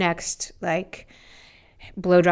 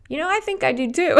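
playful admission; 10 semitones higher, 4 dB louder than usual. Retold or imitated. Playful confession to stranger.

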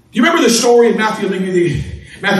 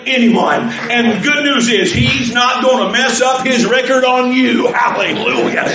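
ordinary talk, nothing marked

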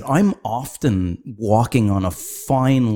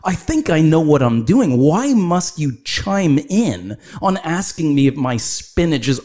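high, mock-outraged reply; fed up with a stranger's organic question, high exasperated; exasperated rant; 7 semitones higher than usual. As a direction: act out the annoyed retort, pitched up. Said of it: "I'm like".

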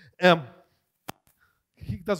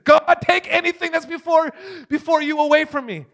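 high, loud plea to God; retold impassioned prayer; urgent prayer; 8 semitones higher, 4 dB louder than usual. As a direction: cry out to God, pitched up and forceful. Retells his urgent prayer to God.

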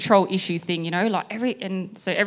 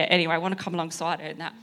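ordinary talk

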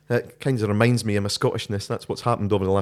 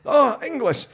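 high, loud, drawn-out Highland call; hammed-up Highlander, pitched way up and slow; 7 dB louder, slower than usual. Highlander replying to English camper downstream, calling down. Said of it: "the Highland guy goes".

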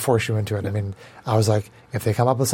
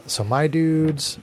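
stretched out slowly; slow drawl; 4 semitones higher, much slower than usual. Acted. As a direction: drag out "my dudes" lazily. GM drawls his monsters' name.

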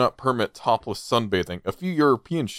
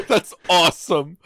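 laughing through the words; 5 semitones higher than usual. Real, not acted. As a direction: crack up while saying it. Laughing at Care Bear image.

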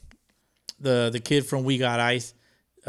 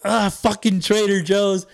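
higher, louder and slow excited call; excited shout-out; 7 semitones higher, 5 dB louder, slower than usual. Retold or imitated. Fan pointing at a creator by nickname, slow and excited. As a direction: stretch it out like spotting a celebrity. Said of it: "I was like".

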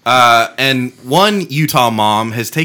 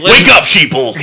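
loud, high paranoid rant; conspiracy theorist; 4 semitones higher, 6 dB louder than usual. Pretend. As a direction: bark it like a frantic radio host. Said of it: "are you Alex Jones".

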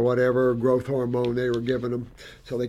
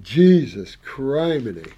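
ordinary talk

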